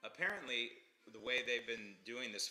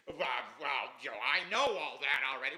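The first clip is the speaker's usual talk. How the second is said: lightly voiced smug TV anchor, a bit louder; mock dismissive anchor; 6 dB louder than usual. Mocking the CNN anchor's dismissive know-it-all reply. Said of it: "And the anchors".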